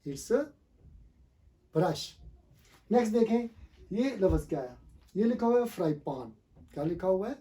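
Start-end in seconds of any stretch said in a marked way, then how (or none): 1.8-2.1 "brush" as one syllable, braash; no vowel between b and r in brush; correct pronunciation. Shown as a demonstration. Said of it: "actually ये word है". 5.7-6.3 "फ्राई पैन" ending in long paan; wrong pronunciation. Shown as a demonstration. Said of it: "not fry pan".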